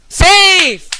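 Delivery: high, loud cry of relief; excited shout. Real, not acted. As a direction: yell it out in triumph. Landing a safe curse roll.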